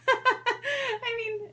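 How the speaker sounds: laughing through the words. Real, not acted. To viewers, laughing at herself for being nerdy. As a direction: laugh at her own nerdiness. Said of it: "that's totally nerdy".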